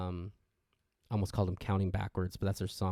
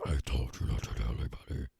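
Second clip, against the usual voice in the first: much lower, otherworldly demon voice; 9 semitones lower than usual. Retold or imitated. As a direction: drop down deep into the demon. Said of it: "she's transforming into that demon".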